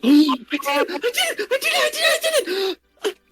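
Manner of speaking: put-on high, laughing little girl squeal; thrilled child. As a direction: squeal with delight at the magic. Child thrilled at her sword.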